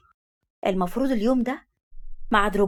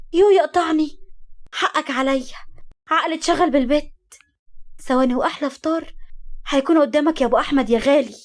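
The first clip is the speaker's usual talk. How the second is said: higher-pitched apologetic wife; flustered eager wife; 5 semitones higher than usual. Acted. Wife promising her husband breakfast in seconds, flustered and eager. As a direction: fuss apologetically over a husband.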